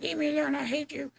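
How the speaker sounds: put-on very high, soft, whiny girl voice; a hammed-up sulky teen brushing them off; whiny mock Emma. Mocking Emma telling the reporters to leave. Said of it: "And Emma's like".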